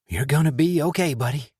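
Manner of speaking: distressed reassurance. A narrator's reading. Tommy reassures his shot friend, tense and distressed. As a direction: comfort him while you are scared for him. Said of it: "though his tone was full of distress".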